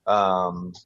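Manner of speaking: usual speaking voice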